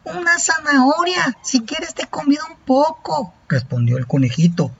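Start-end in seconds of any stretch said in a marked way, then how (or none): 0.0-3.3 high, small bunny voice; little rabbit voice. Acted. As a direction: play a tiny friendly rabbit, pitched way up.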